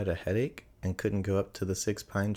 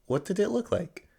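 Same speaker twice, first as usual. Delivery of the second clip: lightly voiced boy; 12 semitones higher than usual. A narrator's reading. Christopher Robin asks.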